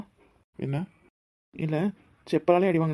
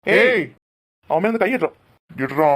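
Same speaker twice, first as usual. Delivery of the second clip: shouted order; 3 semitones higher, 6 dB louder than usual. Acted. Hero orders the thug off.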